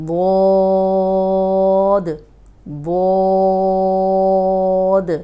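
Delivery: واد held very long and loud, heavy; long heavy dwad. Shown as a demonstration. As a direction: chant واد full and drawn out. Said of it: "یہ بھی موٹا حرف ہے". (واد کو موٹا اور لمبا پڑھنا)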